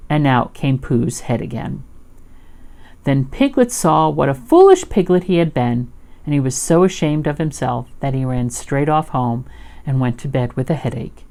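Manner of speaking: plain reading voice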